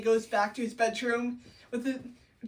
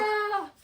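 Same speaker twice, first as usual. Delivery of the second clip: high mock scream with laughter; 9 semitones higher than usual. Desdemona about to be killed, mock scream. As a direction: let out a silly high scream.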